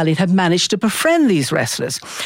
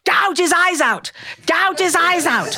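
mock ringside yell; 9 semitones higher than usual. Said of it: "this one was yelling".

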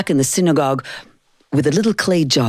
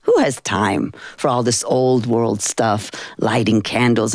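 usual speaking voice

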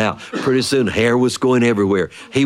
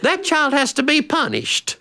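much higher, exasperated mother's scolding; scolding mama. Retold or imitated. Mama scolding daddy for laughing, high and exasperated. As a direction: tell him off in a high fed-up voice.

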